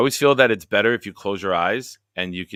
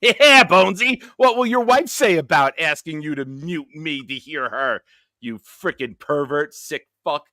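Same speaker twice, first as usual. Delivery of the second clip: higher and louder mock scolding; mock outrage; 6 semitones higher, 7 dB louder than usual. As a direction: play at being outraged at the pervert. Mock scolding Bonesy on stream.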